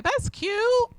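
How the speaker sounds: high-pitched praise through a frown; frowny-faced compliment. Retold or imitated. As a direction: imitate a disapproving onlooker's fake compliment. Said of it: "with a frowny face".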